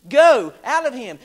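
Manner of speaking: loud, forceful command; booming rebuke to the demons. Acted. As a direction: order the demon out.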